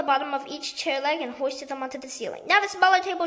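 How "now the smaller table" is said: higher, louder shout; excited shout. A narrator's reading. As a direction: call the next order across the room. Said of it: "shouted Mugglewump".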